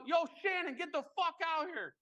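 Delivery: high-pitched Boston holler imitation; mock calling a bandmate over in Boston accent. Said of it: "that whatever Boston accent".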